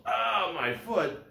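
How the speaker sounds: a high, slow, put-on cry of pain; mock pained yell. Teacher acts out the hurt man's mild mock cry. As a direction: act out the hurt foot, drawn out. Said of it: "the guy yells".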